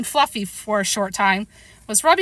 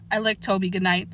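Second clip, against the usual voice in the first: plain reading voice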